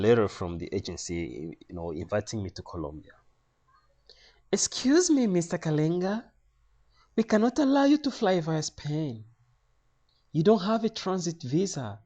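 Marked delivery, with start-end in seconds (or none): 4.5-12.0 high-pitched official refusal; high imitation of a Spanish clerk; Spanish lady official. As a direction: refuse him in the lady's high voice. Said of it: "another lady asks in a Spanish accent".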